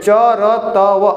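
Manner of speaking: very slowly "च र त व", each sound separate; syllable by syllable. Shown as a demonstration. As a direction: spell out "च र त व" one sound at a time. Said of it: "ऐसे".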